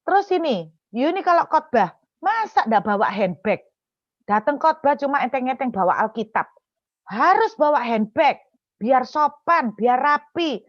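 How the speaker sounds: imitated reprimand, emphatic and insistent; critical aunt. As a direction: lecture like a fault-finding auntie. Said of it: "kritikan yang keempat, dia bilang begini".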